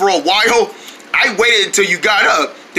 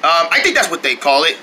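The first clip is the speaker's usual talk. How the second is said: usual speaking voice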